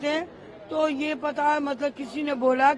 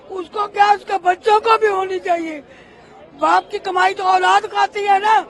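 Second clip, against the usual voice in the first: raised voice, pressing the grievance hard; real angry outcry; 3 semitones higher, 7 dB louder than usual. Real, not acted. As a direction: demand justice at full voice, outraged. Protesting the sentence as unjust.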